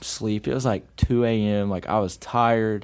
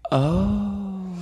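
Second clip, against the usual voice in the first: high, drawn-out awkward oh; awkward surprise; 8 semitones higher than usual. Retold or imitated. Walking in on silent coaches, awkward surprise. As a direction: hold a higher, uneasy "oh".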